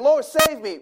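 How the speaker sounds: high, loud cry for help; panicked plea to the Lord; desperate cry. Retold or imitated. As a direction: call out like a man going under. Peter sinking, calls for help.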